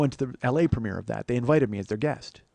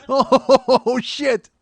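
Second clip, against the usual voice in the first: high, loud laughing exclamation; cracking up; 11 semitones higher, 10 dB louder than usual. Real, not acted. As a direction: burst out, tickled by your own joke. Cracking up at his own joke.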